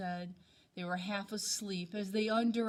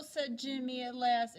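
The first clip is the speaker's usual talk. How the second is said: plain reading voice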